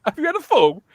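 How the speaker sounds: high-pitched mock-posh accent; Ian's posh accent. Retelling Ian's brilliant remark, high mock-posh accent. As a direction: imitate a posh speaker, pitched right up. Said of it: "how come Ian talks really posh".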